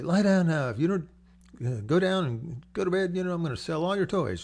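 loud, high mock scolding of a child; irritated parent. Fed-up parent ordering the kids to bed, mock irritated yell. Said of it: "you can get irritated and say".

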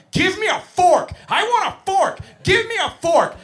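put-on high, loud toddler demand; screaming three-year-old. Three-year-old screaming at the teacher for a fork, loud high tantrum. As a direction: shriek it like a tantruming preschooler. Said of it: "I'm being screamed at point blank range".

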